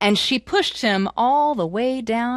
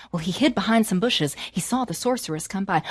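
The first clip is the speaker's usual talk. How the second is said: usual speaking voice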